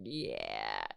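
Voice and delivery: low, soft, blissed-out yeah; drugged yeah. Retold or imitated. Patient on pain meds, a lightly drowsy yeah. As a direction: sink into a dopey yeah. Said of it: "And I'm like".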